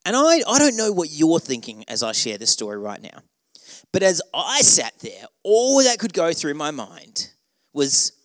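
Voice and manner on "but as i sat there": usual speaking voice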